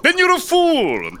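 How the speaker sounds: slow high contemptuous jeer; villain sneer. Acted. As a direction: sneer it down at him.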